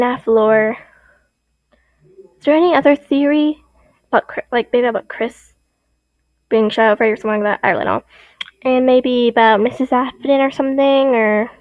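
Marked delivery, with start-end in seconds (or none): none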